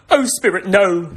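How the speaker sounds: high, pleading tearful Scrooge; tearful cry. Acted. Scrooge pleads with the spirit at his gravestone, acted tearful cry. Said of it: "Scrooge burst into tears".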